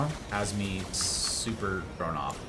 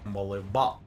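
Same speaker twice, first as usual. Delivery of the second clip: usual speaking voice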